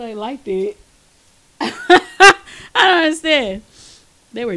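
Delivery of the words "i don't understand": laughing. Real, not acted. Laughing while talking.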